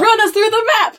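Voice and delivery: excited. Real, not acted. Eager demand, laughing.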